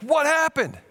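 high-pitched startled question; startled townspeople. Acted. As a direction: blurt it out like a town caught off guard. Startled city reacts to break-in.

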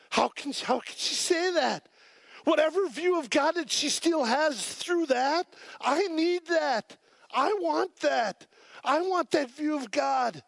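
very high, drawn-out retold cry to God; impassioned plea. Preacher retells asking God for her view of him, impassioned. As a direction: stretch the words out high, pleading with longing.

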